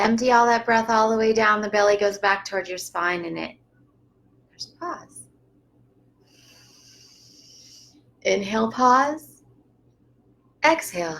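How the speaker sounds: calm breathing-coach voice guiding each breath; unhurried breathing instructor; guided breathing. Shown as a demonstration. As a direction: talk them gently through inhale, pause and exhale. Leads a breathing practice.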